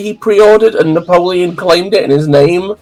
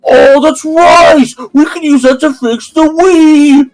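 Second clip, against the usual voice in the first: high, loud, slow mock shout; excited discovery; 11 semitones higher, 4 dB louder, slower than usual. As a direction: announce it like a cartoon genius. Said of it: "he's like".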